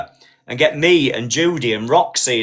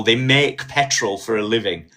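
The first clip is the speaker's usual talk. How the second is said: ordinary talk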